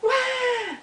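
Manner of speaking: very high wah, held like an echo; echoed wail. Acted. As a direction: answer as a distant echo of a bawl. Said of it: "All the way from across the pond".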